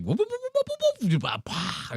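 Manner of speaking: high, swooping imitated cartoon whoops; a silly imitation of a meme noise. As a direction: make the whoop sound effects. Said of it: "that meme noise".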